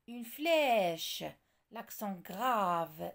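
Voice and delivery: "flèche" and "grave" with long, drawn-out open e and a; prononciation allongée. Shown as a demonstration. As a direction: stretch the vowels of "flèche" and "grave", stressed. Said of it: "la prononciation est allongée". (« flèche » et « grave » aux voyelles bien allongées)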